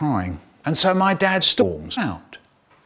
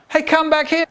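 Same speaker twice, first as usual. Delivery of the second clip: shouting; 13 semitones higher than usual. Retold or imitated. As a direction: yell after him. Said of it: "I shouted out".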